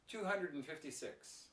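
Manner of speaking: "and" shrunk to a quick, weak "n"; natural reduced pronunciation. Shown as a demonstration. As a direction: run "hundred and fifty" together, "and" unstressed. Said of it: "It's very fast and it's very weak".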